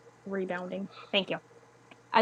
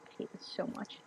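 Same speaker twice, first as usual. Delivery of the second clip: frustrated whisper; 12 dB quieter than usual. Real, not acted. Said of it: "it's just not functioning at all".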